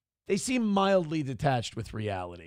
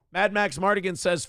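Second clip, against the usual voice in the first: usual speaking voice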